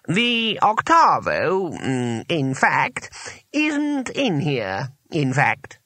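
high, halting, drawn-out admission; slow. A narrator's reading. Wizard admitting the octavo is missing, slowly. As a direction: stall between phrases, picking the words. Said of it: "said the voice slowly".

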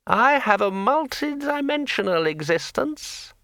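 high, slow, chatty sword voice; a cheerful magic sword rambling on; talking sword. A narrator's reading. Sword Kring speaks.

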